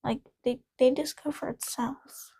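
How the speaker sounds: soft whispered disbelief; whispering. Real, not acted. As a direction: whisper it to yourself. Muttered reaction while reading.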